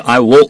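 "will" shortened to "wo"; natural reduced "will", no clear L; American reduced will. Shown as a demonstration. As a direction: say "I will" as "I wo", L swallowed. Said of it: "이렇게 거의 발음을 합니다".

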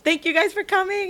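high, bubbly fangirl gush; gushing fan. Retold or imitated. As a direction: gush your thanks breathlessly high. Gushing at idols at hi-touch.

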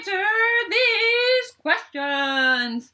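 slow announcer voice. Pretend. Mock announcer drawl.

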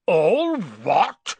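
loud, rough giant's roar; booming, incredulous echo; thundered. A narrator's reading. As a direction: thunder it back in outrage. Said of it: "Hagrid thundered".

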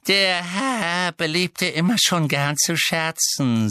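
high, slow, drawn-out fawning; oily servant flattery in a creature voice; obsequious elf. A narrator's reading. Bowing elf humouring his master, obsequious.

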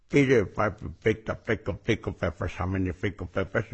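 tongue-tied by a pen clenched in the teeth; with a pen in the mouth. Shown as a demonstration. Speaker shows his pen drill, pen in mouth. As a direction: talk around a pen held in the molars. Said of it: "Te pones la pluma en la boca y empiezas".

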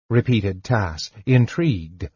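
ordinary delivery, nothing marked

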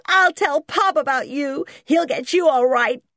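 high, loud tearful threat; weeping. A narrator's reading. Boy threatening George with his father, tearful whining. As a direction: wail a childish threat. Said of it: "he wept".

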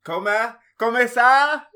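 high, loud hammed-up Italian; mock Italian. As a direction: put on a big Italian host voice. Mock Italian greeting reply.

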